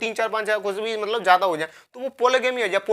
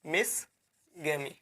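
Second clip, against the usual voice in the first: मिसगैमी very slow and soft, split in two parts; मिसगैमी lower, with a long pause before its ending; slow dictation; 6 semitones lower, 10 dB quieter, much slower than usual. Shown as a demonstration. Teacher writing the term out for students, slow dictation of मिसगैमी.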